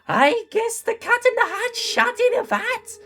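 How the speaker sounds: very high cartoon Gru accent; weird Gru accent. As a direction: do a squeaky, silly Gru accent. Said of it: "in his weird Gru accent".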